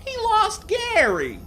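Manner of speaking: very high, loud, drawn-out cartoon voice; Sandy. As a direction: squeal it slowly in character. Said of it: "Sandy said".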